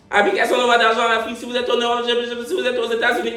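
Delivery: fast, high-pitched memorized pitch; automatic ad recital. Shown as a demonstration. Vlogger showing the app pitch is known by heart, automatic recital. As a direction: rattle it off like a reflex. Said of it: "Dès que je me pince".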